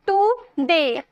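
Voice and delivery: टुडे in two separate beats, टु, डे; split into syllables. Shown as a demonstration. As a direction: break टुडे after टु with a short gap. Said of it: "दो syllable आए ना". (टुडे को दो हिस्सों में तोड़कर)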